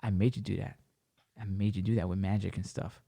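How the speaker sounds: low, soft imitation of her; quiet eerie claim. Woman claiming magic control over him, retold quietly. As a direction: drop down and say it softly, knowingly.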